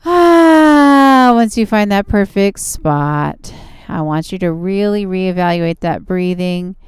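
calm and soothing, easing into rest. Acted. Yoga relaxation cool-down.

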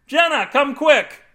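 high, loud, stretched holler; urgent calling out. A narrator's reading. Husband calling wife in the bathroom, urgent holler. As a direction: summon her from another room.

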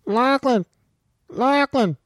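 put-on high, whiny kid calling out; Jackson. Whiny kid calling his friend in the game, high-pitched imitation. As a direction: call the name in a high, put-on whine. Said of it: "You know, that's Jackson".

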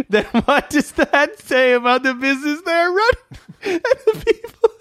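very high, squeaky laughing read; helpless laughter. Real, not acted. Reader losing it over a silly review, helpless high laughter. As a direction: read on while cracking up.

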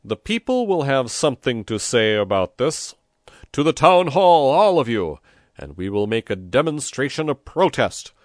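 loud, high rallying call; shouted to crowd. A narrator's reading. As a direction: rouse the crowd to march. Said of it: "turned to the crowd in the church square and shouted".

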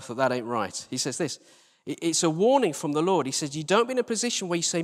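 usual speaking voice

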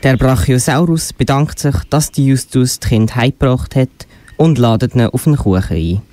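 usual speaking voice

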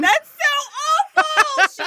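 laughing mock horror; horrified laugh. Real, not acted. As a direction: drag it out, laughing and appalled. Reacting to bloody snowman photo.

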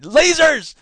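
loud, high "Lasers!"; mock excitement. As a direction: burst out as if gleefully thrilled. Mock exclamation after dry article.

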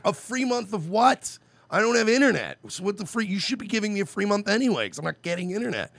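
incredulous rant, high and loud; retold outrage.

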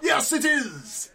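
high, slow villain drawl; Joker voice. Acted. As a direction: stretch it out like a madman. Joker character.

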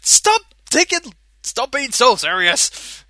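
hammed-up order to lighten up; mock loud scold. Sonic's character snapping at friends, loud mock scold.

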